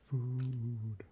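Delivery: normal talking voice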